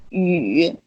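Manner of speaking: "Yu" low, dipping then rising; drawn-out low dip on "Yu"; dipping third tone. Shown as a demonstration. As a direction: sink "Yu" low and bring it up at the end. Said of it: "this is this one".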